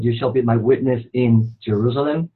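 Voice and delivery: normal talking voice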